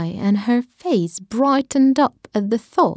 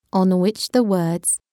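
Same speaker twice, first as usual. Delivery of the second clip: usual speaking voice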